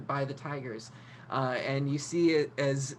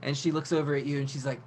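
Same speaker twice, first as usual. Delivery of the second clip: ordinary delivery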